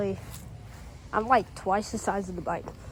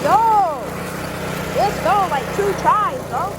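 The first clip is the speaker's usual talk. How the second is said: loud, high cheer of joy; excited shout; 9 semitones higher, 10 dB louder than usual. Real, not acted. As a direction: yell it out, thrilled. Bike starts, excited cheer.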